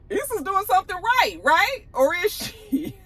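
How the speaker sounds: high-pitched question breaking into laughter; amused teasing. Real, not acted. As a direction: tease it high, then crack up laughing.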